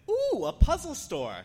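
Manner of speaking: high, loud cooing excitement; mock delight. Imagined shopper spotting a shop, mock excited. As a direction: squeal it like a thrilled shopper.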